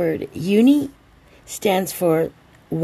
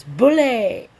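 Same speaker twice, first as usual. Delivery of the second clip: higher, louder toast call; celebratory cheer; 5 semitones higher, 5 dB louder than usual. Real, not acted. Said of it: "I forgot to do the cheers".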